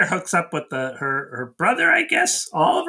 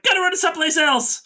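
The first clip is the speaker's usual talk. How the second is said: mock hurried soldier; 12 semitones higher, faster than usual. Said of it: "And then they're like".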